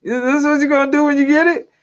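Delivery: acted-out incredulous question, pitched high; high-pitched disbelief at what someone is doing; mock incredulous. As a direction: quote them in a high, baffled voice. Acted incredulous reaction in a story.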